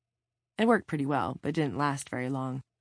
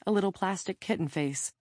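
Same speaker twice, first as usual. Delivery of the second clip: ordinary delivery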